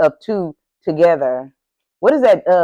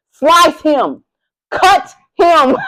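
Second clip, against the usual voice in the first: gleeful mock order; 7 semitones higher, 5 dB louder, much slower than usual.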